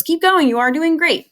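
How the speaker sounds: bright, high and loud cheer; cheery encouragement. Acted. Teacher reading the worksheet's cheer to students, high and loud. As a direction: cheer the kids on from the card.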